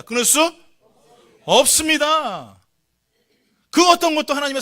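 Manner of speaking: loud, high call with a long-held ending; emphatic call. Shown as a demonstration. Preacher has congregation repeat the verse, loud emphatic call. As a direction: cry it out and stretch 없습니다.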